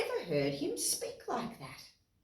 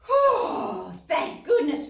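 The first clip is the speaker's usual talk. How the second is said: relieved whew; 9 semitones higher, 6 dB louder, slower than usual. Acted. Relief exclamation.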